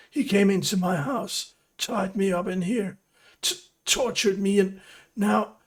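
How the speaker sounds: high, urgent plea; frightened prisoner. Acted. Prisoner Pernisk pleading with his rescuer, high and urgent.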